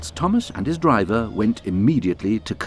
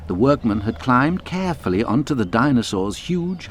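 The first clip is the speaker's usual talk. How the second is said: plain reading voice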